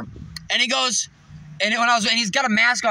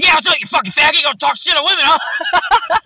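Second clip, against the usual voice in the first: loud, high challenge; acted confrontational shout; 7 semitones higher, 7 dB louder than usual. Confronting the man who cursed at women, angry shout. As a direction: square up and yell the challenge. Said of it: "and I said".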